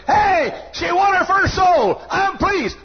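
high, loud happy exclamation; a voice of heaven shouting for joy; delighted God. Retold or imitated. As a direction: cheer it like a proud father.

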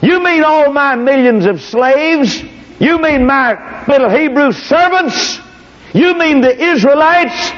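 high, slow, sneering disbelief in a put-on voice; mocking Pharaoh. Pharaoh scoffing at Moses over his slaves, high slow sneer. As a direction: drag it out, laughing at the demand.

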